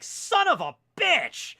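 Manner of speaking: high, loud, slow outburst of cursing; retold curse. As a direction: yell the curse in shocked frustration. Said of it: "I verbally was like".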